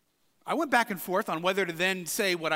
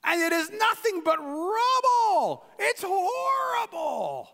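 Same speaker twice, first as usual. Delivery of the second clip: shouted sneer in a put-on English accent, much higher; mocking English accent; 9 semitones higher, much slower than usual. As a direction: Tobiah jeering slowly at the wall. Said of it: "just completely mocking them".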